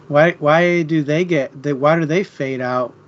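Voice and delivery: normal talking voice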